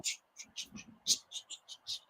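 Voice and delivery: very soft unvoiced shushing; shushing the pipe. Trying to calm the spraying pipe, soft shushing. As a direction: shush the water like a child. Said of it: "and I was going".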